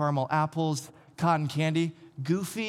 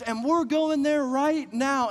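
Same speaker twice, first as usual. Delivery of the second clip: excited dad; 10 semitones higher, 3 dB louder than usual. Retold or imitated. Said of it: "my dad goes".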